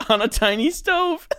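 giggling through the words; laughing. Real, not acted. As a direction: laugh while you say it. Giggling over tiny food idea.

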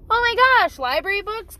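high, loud mock amazement; excited dad voice. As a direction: gush like a dad walking in the door. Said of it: "dad comes home and he says".

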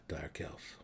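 hushed, unvoiced whisper. A narrator's reading. The resigned gnome whispers the lesson to the drow. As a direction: breathe the name in resignation.

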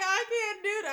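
high whimpering refusal; mock crying whine. To her pastor, whining she cannot fast. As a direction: whine it like a tired child. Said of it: "I was like".

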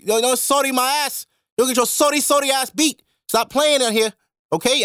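high, heated scolding; angry retold yell. Scolding the sneezer in the chicken spot, angry. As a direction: tear into him for sneezing. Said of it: "I'm like".

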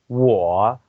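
我 with a dipping tone; third tone. Shown as a demonstration. Teacher showing a listener the pronunciation, 我 in dipping third tone. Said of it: "我是三声".

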